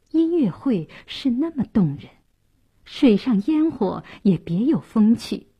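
ordinary delivery, nothing marked

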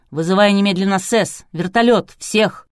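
loud, urgent command; demanding. A narrator's reading. Producer orders the doctor to call everyone, demanding. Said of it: "потребовал".

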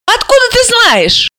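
high-pitched surprised question. A narrator's reading. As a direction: ask in astonishment, voice jumping up. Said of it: "удивилась Алиса".

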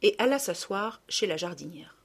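usual speaking voice

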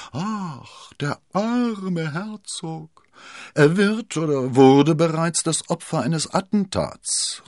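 high, drawn-out mock lament; feigned pity. A narrator's reading. Milady pretends to mourn the poor duke, feigned pity. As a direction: gush with exaggerated, insincere sympathy. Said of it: "rief Milady mit gespieltem Mitleid".

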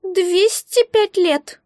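high, very slow squeak; calculating creature. A narrator's reading. Egg finishes counting its years, high and drawn-out. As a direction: announce the figure slowly in a little voice. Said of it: "протянуло существо, подсчитывая".

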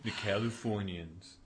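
drawn-out mock Californian accent. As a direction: stretch it out in a spoofed California accent. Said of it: "that S N L sketch".

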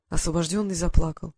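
usual speaking voice

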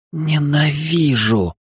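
guttural growl. Acted. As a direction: growl it like a wild beast. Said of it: "утробным голосом прорычала Рокси".

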